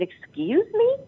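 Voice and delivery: "Excuse me?" shot up very high with a sharp rising end; outraged disbelief, leap way up on "Excuse me?"; indignant excuse me. Retold or imitated. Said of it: "I said".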